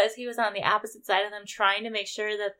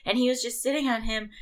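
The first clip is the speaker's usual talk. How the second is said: normal talking voice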